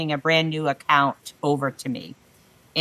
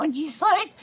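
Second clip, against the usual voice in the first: ordinary talk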